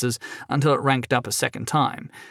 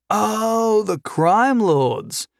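high, slow dawning "oh"; realization dawning; 9 semitones higher, much slower than usual. Acted. Prisoner finally places his captor's name, slow dawning realization. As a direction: let it dawn on you slowly and brightly. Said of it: "realization dawning in his voice".

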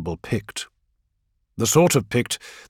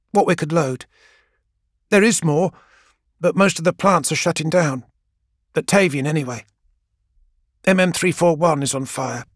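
lightly voiced young woman, higher, steadying herself after sniffs; tearful young woman reporting; 4 semitones higher than usual. Gang girl reporting the supply situation to Keeler, shaken. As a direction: report the losses, holding back tears.

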